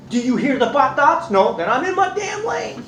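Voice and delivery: husband proving he's in his lane; exasperated. Retold or imitated. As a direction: make the point hard, fed up. Retold quarrel with wife.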